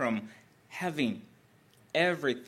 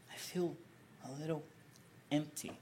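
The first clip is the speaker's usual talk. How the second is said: softer, hushed admission of emptiness; quiet confession; 2 semitones higher, 8 dB quieter, faster than usual. Retold or imitated. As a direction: let the words sink into a soft confession. Mary confesses emptiness to Jesus.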